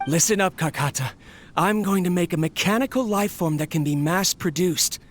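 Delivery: ordinary delivery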